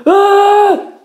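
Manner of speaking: high loud scream of pain; a choked scream from inside; muffled high scream. A narrator's reading. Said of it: "Edward screamed inside".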